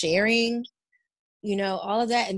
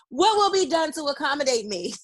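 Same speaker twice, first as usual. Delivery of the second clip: raised-pitch mock complaint; demanding customer; 5 semitones higher than usual. Friend teasing the business owner as a client, mock demanding. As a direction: play an entitled client pressing for service. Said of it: "everybody's gonna go right to your business".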